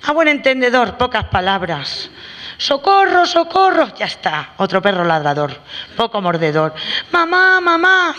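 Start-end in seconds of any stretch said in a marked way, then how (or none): 2.6-3.9 put-on high, loud cry of socorro like a scared kid; hammed-up child yelling for help; mock child scream for help. El niño grita pidiendo ayuda. 7.1-8.2 high, loud, slow wail for mamá; hammed-up scared kid calling his mum; mock child crying out. El niño llama a su madre.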